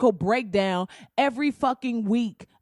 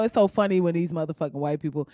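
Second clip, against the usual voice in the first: ordinary talk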